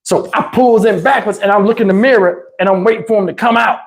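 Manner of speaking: usual speaking voice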